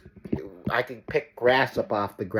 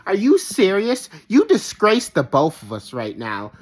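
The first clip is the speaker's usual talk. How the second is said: mocking the scolding mother; 5 semitones higher, 4 dB louder than usual. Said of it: "the audacity in her voice".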